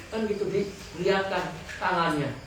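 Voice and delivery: usual speaking voice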